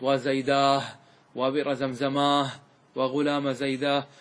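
level, drawn-out "aah" endings on وزيداه and زمزماه; ha of pause. Shown as a demonstration. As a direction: end وزيداه on long aa plus audible h. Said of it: "هذه الهاء من أين جاءت؟".

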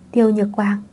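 ordinary delivery

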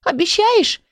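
high-pitched, mild tearful plea; sobbed question. A narrator's reading. Little book seeks a promise from keeper, tearful. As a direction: ask like a small child near tears. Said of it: "всхлипнула та".

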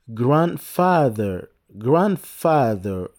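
slow, careful teacher drill of "Grandfather"; slow drill word. Shown as a demonstration. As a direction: spell out "Grandfather" slowly, syllable by syllable. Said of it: "répétez après moi".

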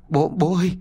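high cry to his father. Acted. Boy runs to his father, calling out high. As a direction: call out to dad, high. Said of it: "Thằng Đạt gào lên".

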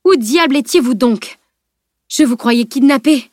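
flabbergasted, forceful outburst; indignant exclamation. A narrator's reading. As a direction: demand an explanation from a runaway. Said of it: "s'écria-t-il".

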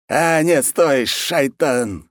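high start, drawn-out last word; disappointed exclamation. Acted. Khan halts execution too late, disappointed drawn-out curse. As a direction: call a halt, then drag the curse. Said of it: "разочарованно сказал хан".

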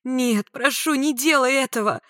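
high, urgent begging; desperate pleading. A narrator's reading. Woman pinned to wall, pleading desperately. Woman begs attacker to stop.

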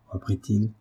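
plain reading voice

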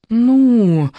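long unhappy groan; drawn-out displeased "nu". A narrator's reading. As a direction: whine it out, disappointed. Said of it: "в один голос недовольно протянули".

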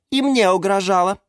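high, snide tirade; high-pitched scornful complaint; spiteful tattling. A narrator's reading. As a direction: pile on accusations maliciously. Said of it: "ехидно поддакнула".

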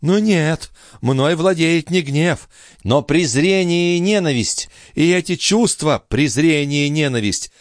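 high, passionate contempt; impassioned, scornful tirade; increasingly heated. A narrator's reading. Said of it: "всё более распаляясь".

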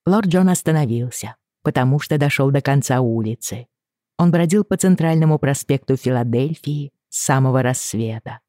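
ordinary delivery

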